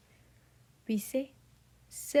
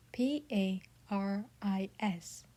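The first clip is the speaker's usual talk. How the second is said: P A R I S spelled slowly, letter by letter; spelling out. Shown as a demonstration. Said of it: "We spell the capital".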